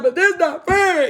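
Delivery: high, loud, drawn-out mock wail; retold tearful protest. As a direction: protest like an upset child, stretching it out. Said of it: "Y'all, I am crying".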